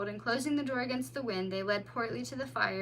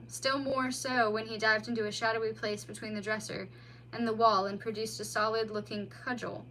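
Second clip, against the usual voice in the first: usual speaking voice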